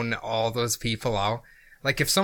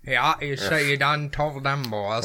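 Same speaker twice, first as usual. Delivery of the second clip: slow country hillbilly voice; hillbilly drawl; 3 semitones higher, slower than usual. Retold or imitated. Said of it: "like a fucking hillbilly".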